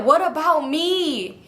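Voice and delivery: drawn-out, high wounded plea; mock wail. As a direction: plead it slowly, hammed-up hurt. Retold tearful plea to boyfriend.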